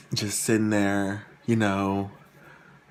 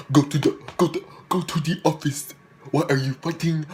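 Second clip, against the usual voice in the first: coach impression; 6 semitones higher than usual. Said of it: "And there's this coach".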